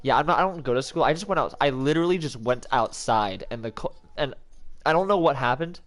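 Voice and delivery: ordinary delivery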